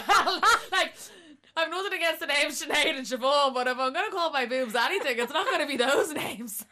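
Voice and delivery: high and loud, laughing through the words; laughing as she retells answering her date; laughing incredulous reply. Retold or imitated. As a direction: laugh it off in disbelief. Retelling her reply about boob names.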